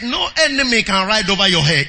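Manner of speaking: ordinary delivery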